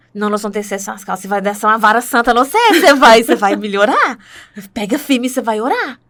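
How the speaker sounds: quick, building to a loud mock threat; joking mock scolding. Friends jokingly push her to pray, mock-stern scolding. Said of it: "brincou, ainda uma delas brincou comigo".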